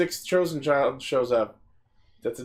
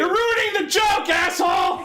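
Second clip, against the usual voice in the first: yelling; 12 semitones higher than usual. Real, not acted. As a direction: shout it in outrage. Joke spoiled, yells at cohost.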